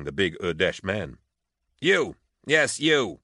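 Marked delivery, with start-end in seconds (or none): 1.8-3.2 loud, high commanding shout; yelling. A narrator's reading. Officer yelling at squabbling troopers to get their attention. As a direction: yell across a crowded hall. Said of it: "Hark yelled".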